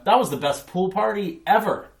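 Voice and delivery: high, loud gushing; excited kid. A narrator's reading. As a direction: gush about the best party ever. Fiona raves about party.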